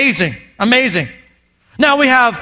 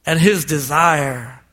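tender scripture reading; 7 semitones lower, slower than usual. Acted. Pastor reads the bride's reply from Song of Songs, low and tender. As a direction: read it like a love poem.

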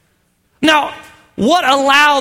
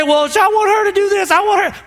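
higher, whiny complaining guy; mock whiny husband; 9 semitones higher than usual. Pastor mocks a demanding husband, whiny and high. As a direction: whine it like a needy husband.

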